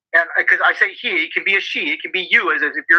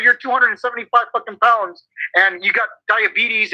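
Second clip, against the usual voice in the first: ordinary talk, nothing marked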